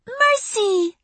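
put-on very high, squealing little-girl voice; high-pitched kid greeting a friend. A narrator's reading. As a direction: call out in a tiny child's high voice. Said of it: "said a high-pitched voice".

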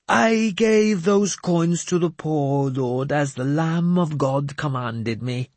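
put-on high, slow, grandly pious old madman; a cracked holy man delivering God's message; mad bishop voice. Jeremias explains piously.

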